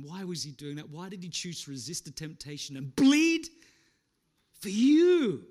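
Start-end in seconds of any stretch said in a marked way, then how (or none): none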